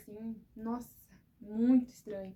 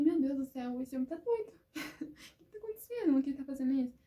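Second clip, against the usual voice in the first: alarmed disbelief; 4 semitones higher, 5 dB louder than usual. Retold or imitated. Husband running around house, alarmed.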